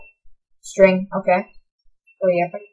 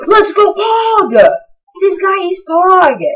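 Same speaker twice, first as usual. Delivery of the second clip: high, drawn-out, slow cheering; slow excited shout; 8 semitones higher, 3 dB louder, slower than usual. Real, not acted. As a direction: stretch each word in triumph. Cheering the generous piglin.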